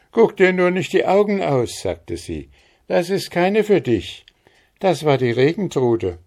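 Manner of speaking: viel höher und lauter, resolut; neckend zurechtweisend. Acted. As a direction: forsch abwehren. Maren scolds Andres playfully. (Maren weist Andres laut und viel höher neckend zurecht)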